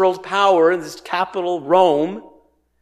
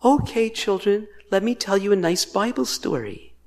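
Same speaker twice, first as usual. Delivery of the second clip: mock children's storyteller; 3 semitones higher, 4 dB quieter than usual. Teacher addressing children with a Bible story, sweetly mocking. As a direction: coo it as if to little children. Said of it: "This is not".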